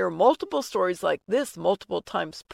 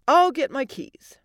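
higher, louder and urgent; commanding; 5 semitones higher, 7 dB louder than usual. Acted. Wife taking charge of hospital dash, commanding. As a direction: announce it briskly, taking charge. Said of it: "Allie commanded".

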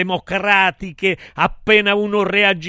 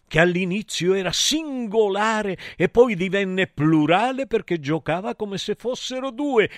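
usual speaking voice

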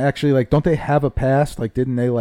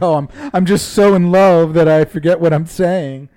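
louder, mock-gushing quote; hammed-up declaration of love; imitating lovestruck wrestler; 3 semitones higher, 4 dB louder than usual. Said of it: "And she's like".

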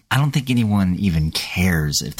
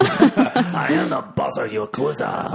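put-on Yakuza boss, slow, in a Japanese accent; slow Japanese gangster accent; 7 semitones higher than usual. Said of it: "You sound like a Japanese drug lord".

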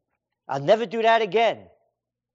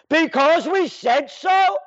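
slow, loud, higher bark of an official; mock official shouting; 7 semitones higher, 3 dB louder, slower than usual. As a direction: shout it down at him as the authority. Official barks at citizen.

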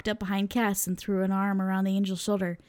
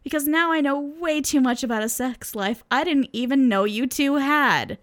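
louder and higher, slowing at the end; a loud complaint that drags out at the finish; yelling; 6 semitones higher, 5 dB louder than usual. Acted. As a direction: keep shouting in disgust. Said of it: "Sam yelled".

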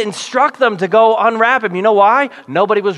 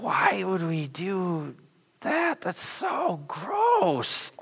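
mock disgusted whine; 11 dB quieter, much slower than usual. Crowd at the stinking tomb.

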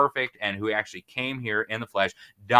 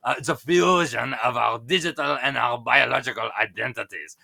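imitating a German accent, lightly; a loose German accent impression; 2 semitones higher, 3 dB louder than usual. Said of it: "my, my German accent is not perfect".

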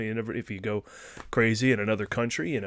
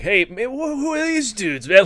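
much higher, louder loopy stoner voice; mock drunk dude; 10 semitones higher, 5 dB louder than usual. Doctor acting drunk at the club, hammed-up stoner voice. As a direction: act sloppy and laid-back, like a guy who's had too much. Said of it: "that whole drunk scene".

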